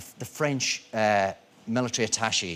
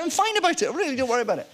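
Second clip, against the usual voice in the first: own reassuring reply; 12 semitones higher, faster than usual. Retold or imitated. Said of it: "And I'm saying".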